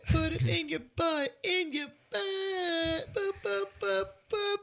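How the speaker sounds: very high, whiny rap voice; squeaky rapper parody. Pretend.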